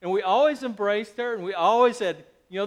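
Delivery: ordinary talk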